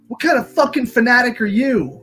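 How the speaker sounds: high, loud mock indignation; mock outrage. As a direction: pretend to be scandalized.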